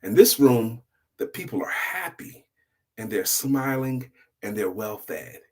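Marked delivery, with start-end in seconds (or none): none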